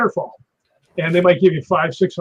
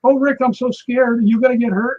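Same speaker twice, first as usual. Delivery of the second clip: scared question; 6 semitones higher than usual. Retold or imitated. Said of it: "and she goes".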